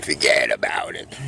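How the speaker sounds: low gruff wiseguy voice; Brooklyn catchphrase. Acted. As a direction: growl it like a Brooklyn tough guy. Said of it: "I'm gonna say".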